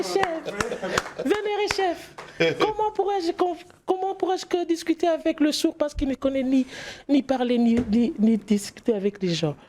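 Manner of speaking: put-on very high, slow young girl's voice. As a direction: plead up high like a timid girl. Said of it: "la voix de la fille".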